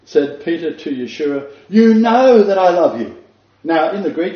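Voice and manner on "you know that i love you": louder, exasperated protest. Retold or imitated. Peter to Yeshua, exasperated and insistent. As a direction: Peter fed up with being asked. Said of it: "it's exasperation".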